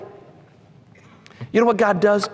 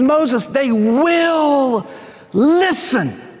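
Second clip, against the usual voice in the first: slow, drawn-out promise, higher; God reassuring Moses; 7 semitones higher, much slower than usual. Acted. Preacher voices God reassuring Moses, slow and drawn out. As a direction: stretch every word.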